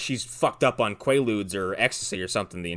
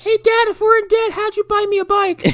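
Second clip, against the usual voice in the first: very high squeaky daughter imitation; high-pitched, hammed-up kid questioning Dad; mock kid voice; 6 dB louder than usual. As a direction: pipe up in falsetto like a child. Acting the daughter questioning her dad.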